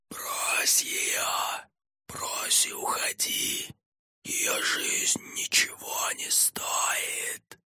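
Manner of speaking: slow, unvoiced ghostly whisper; sinister inner voice. A narrator's reading. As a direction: whisper temptation inside his head. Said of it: "голос из Фаты".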